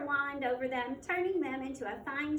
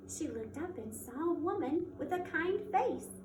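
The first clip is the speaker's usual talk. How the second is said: plain reading voice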